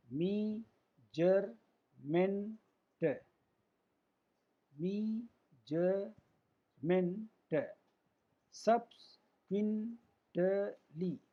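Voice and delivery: very slow, each syllable set apart; long pauses inside every word; teacher's drawn-out long-word demo. Shown as a demonstration. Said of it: "तीन स्वर से अधिक इनका उच्चारण".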